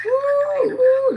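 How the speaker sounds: high squealing ooh into a laugh. Real, not acted. Teasing a viewer about the snore, laughing squeal. Reacting to snoring clip, laughing.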